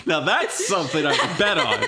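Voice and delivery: laughing through the words. Real, not acted. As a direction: chuckle while joking. Laughing at fart joke.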